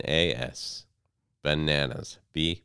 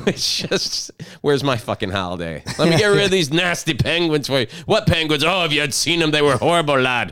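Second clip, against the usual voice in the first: high, loud mock Irish saint complaining; mock-indignant saint defending his penguin claim; mock Saint Patrick; 9 semitones higher, 6 dB louder than usual. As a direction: ham up a boastful Saint Patrick. Saint Patrick voiced, penguins joke.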